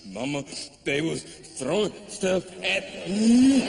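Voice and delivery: slow, mock-pitiful whimper; halting tattle that climbs into a mock wail; whimpering to mama. Comedian phones parents after gig, mock whimpering. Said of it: "like a little bitch on the phone to my parents".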